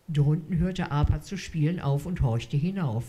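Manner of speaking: ordinary delivery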